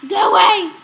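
loud, sharp shout; real shout. Real, not acted. Owner shouting at his biting dog, loud real shout. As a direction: bark it out to drive him off.

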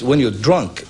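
usual speaking voice

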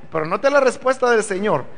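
ordinary talk

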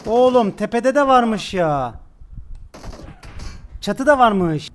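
excited callout. Real, not acted. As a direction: warn the team urgently. Spotting an enemy on the roof.

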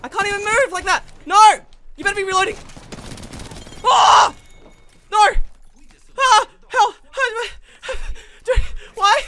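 very high, loud yelps; panicked yelling. Real, not acted. Player under fire and stuck, panicked yelling. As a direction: yell in real panic. Under attack, cannot move.

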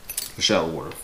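usual speaking voice

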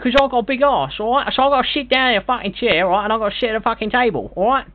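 hammed-up Cockney geezer, swearing freely; brash put-on London bloke demanding a chair; London accent. Prank caller in put-on London voice.

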